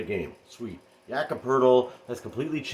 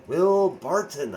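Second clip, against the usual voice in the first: "Will Barton" drawn out slowly, higher and louder; exasperated; 5 semitones higher, 6 dB louder, much slower than usual. Real, not acted. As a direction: groan his name in disbelief. Exasperated about a disappointing player.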